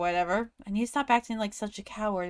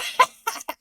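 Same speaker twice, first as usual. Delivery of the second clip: breathy laugh; 4 dB louder than usual. Real, not acted. Player laughs after fix.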